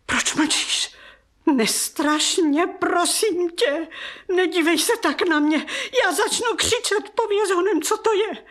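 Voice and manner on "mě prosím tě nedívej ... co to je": higher, urgent and scared; frightened pleading. Acted. Aunt begs silent Liza to answer, frightened and high. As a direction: push her to speak before panic breaks.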